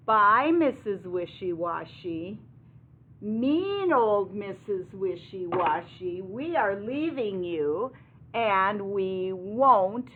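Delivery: loud, emphatic mock-angry farewell; hammed-up indignant goodbye; mad storybook animals. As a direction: declare it as fed-up farm animals, slowing at the end. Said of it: "They're really mad at her".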